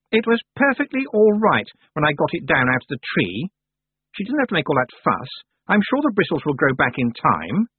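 put-on high, sharp girl's voice; haughty young witch Anagramma. A narrator's reading. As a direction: voice the proud young witch, peevish. Anagramma's voice in dialogue.

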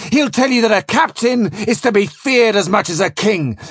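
loud, high, emphatic rage; furious tirade. A narrator's reading. Captain warning Flashman with Seneca on the anger of kings, furious. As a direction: hammer out each threat like an enraged tyrant.

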